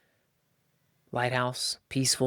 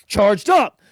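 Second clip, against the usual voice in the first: normal talking voice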